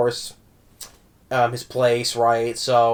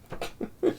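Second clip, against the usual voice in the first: breathy laugh; 12 dB quieter than usual. Real, not acted. Laughing after the impression.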